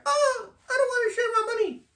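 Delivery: high falsetto crying whine; whimpering mock of a woman; mocking whiny woman. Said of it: "You're like".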